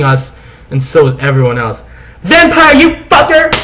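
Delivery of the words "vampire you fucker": high, loud furious cry; angry outburst. Acted. Girl slapping the vampire, shouting angrily. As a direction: snap at him as you slap. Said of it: "I said, slapping him".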